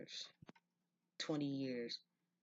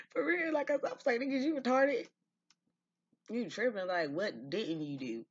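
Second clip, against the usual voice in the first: much higher and louder, cracking up; laughing retort; 10 semitones higher, 9 dB louder than usual. Retold or imitated. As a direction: howl the comeback through laughter. Laughing, retelling his retort.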